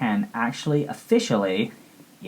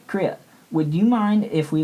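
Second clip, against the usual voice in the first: voicing Japan; 8 semitones higher than usual. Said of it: "and Japan was like".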